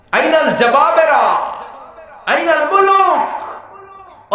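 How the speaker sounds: high, slow drawn-out call; long high shouted call. Acted. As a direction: cry it out over empty space. Divine call across empty world.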